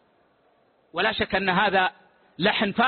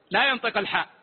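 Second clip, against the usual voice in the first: ordinary talk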